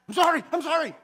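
high, loud, comic yelp of apology; mock panicked apology. Preacher re-enacts apologizing to God after the meteor, comically panicked. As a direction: blurt it out high as if begging off punishment.

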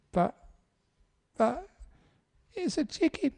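very high, halting whimper; mock tearful wife. Wife crying to her husband after the argument, mock tearful squeak. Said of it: "And then she started to cry".

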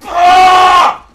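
high, loud gamer outburst; frustrated shouting. Real, not acted. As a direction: yell in real exasperation at the game. Gamer killed, yells at game.

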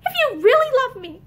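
desperate pleading. A narrator's reading. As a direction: plead with a friend as if breaking down. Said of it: "she broke down to plead desperately".

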